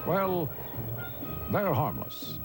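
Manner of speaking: ordinary delivery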